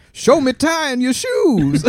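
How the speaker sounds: slow, high, loud host call; Steve Harvey. Acted. Host calling the answer to the board, slow loud showman cry. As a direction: draw it out like a game-show reveal, hammed up.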